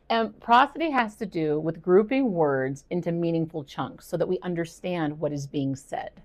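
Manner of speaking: ordinary talk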